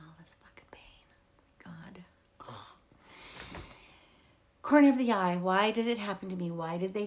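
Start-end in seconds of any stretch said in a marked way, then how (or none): 0.1-3.6 whispered venting. Shown as a demonstration. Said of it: "tapping demo, venting pain".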